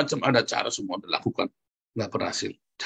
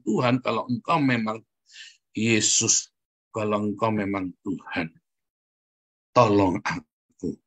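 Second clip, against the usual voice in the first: slow, lowered pleading prayer; earnest prayer; 3 semitones lower, slower than usual. Shown as a demonstration. Preacher models how to beg God for help, earnest prayer. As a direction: draw out the plea, near tears at the end. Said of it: "Saudara harusnya begitu doanya".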